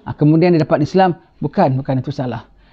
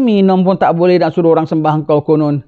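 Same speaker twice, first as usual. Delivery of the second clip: ordinary talk, nothing marked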